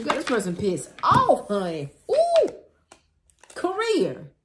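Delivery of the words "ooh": high excited ooh. Real, not acted. Reacts to the next card.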